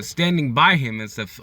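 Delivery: usual speaking voice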